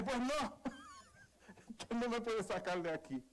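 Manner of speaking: hammed-up angry son talking back; retold angry comeback. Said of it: "se me salió el viejo hombre".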